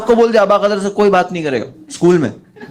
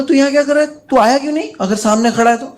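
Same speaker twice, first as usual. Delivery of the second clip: calling out; 10 semitones higher, faster than usual. Retold or imitated. Friend yelling across the parking lot, high calling shout. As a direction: yell at the boy standing there.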